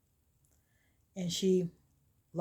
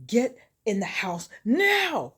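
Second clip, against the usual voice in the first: slow, loud, raised-pitch command; angry order; 4 semitones higher, 5 dB louder, slower than usual. Retold or imitated. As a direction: order them off furiously, word by word. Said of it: "went off on Dennis".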